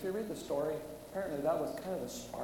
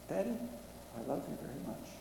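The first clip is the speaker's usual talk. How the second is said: much softer, hushed daughter's words; tender imagined words; 2 semitones higher, 3 dB quieter, faster than usual. Pretend. Father imagines his own daughter's final words, soft and tender. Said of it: "I hope that's my daughter's last words".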